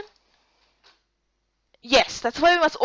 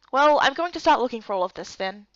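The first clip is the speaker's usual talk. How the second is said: usual speaking voice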